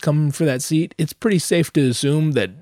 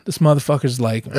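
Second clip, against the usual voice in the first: ordinary talk, nothing marked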